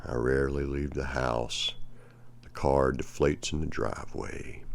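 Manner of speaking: reading a poem aloud to the class, unhurried; teacher reads the Billy Collins poem to students, measured and expressive. Acted. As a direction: let each image land as you read the verse.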